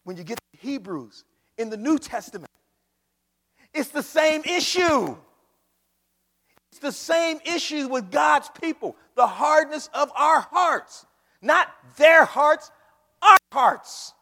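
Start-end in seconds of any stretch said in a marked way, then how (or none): 3.7-14.1 loud, slow, high preacher's climax; full-force preaching. Acted. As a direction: thunder it out to the congregation.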